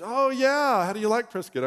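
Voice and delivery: put-on high, keen voice for the young runner; perky runner asking about Prescott; eager runner. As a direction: pitch it up like an eager stranger. Voicing the nervous runner.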